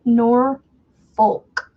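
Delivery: "Norfolk" split into NOR, pause, FOHK; careful pronunciation. Shown as a demonstration. Resident showing her own way, "Norfolk" as careful NOR... FOHK. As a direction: say "Norfolk" slowly as two separate full syllables. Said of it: "is how I would pronounce it".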